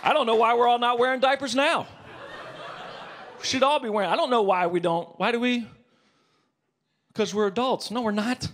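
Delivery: manic rant. Real, not acted. Manic rant about wearing diapers.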